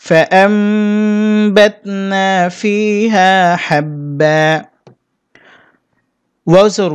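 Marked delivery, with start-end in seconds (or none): 0.0-4.4 slow melodic tajweed chant; slow sung Quran recitation. Shown as a demonstration. Teacher recites Quran verse.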